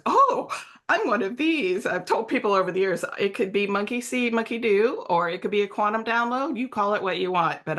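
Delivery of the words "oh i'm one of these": high, lifted "oh" of discovery; retold moment of delighted discovery; sudden realization. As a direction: voice a dawning revelation. Retells her moment of sudden realisation.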